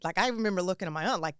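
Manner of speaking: normal talking voice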